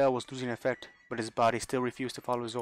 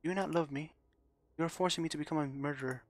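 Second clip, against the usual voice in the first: put-on high, hurt voice of a girl begging her mother; a lost daughter's trembling plea; pleading girl; 4 semitones higher than usual. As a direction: beg for an answer that never comes. Rosa pleading with absent Mother.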